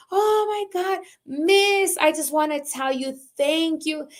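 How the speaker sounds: high-pitched imitation of an excited student. Student running up to thank her teacher, imitated excitement. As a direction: gush like a thrilled student running up. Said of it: "like, so excited".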